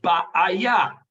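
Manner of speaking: "Ba'aya" called out loud and clear; loud Hebrew word. Shown as a demonstration. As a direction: announce "Ba'aya" to the class. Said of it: "What does the Hebrew word ba'aya mean".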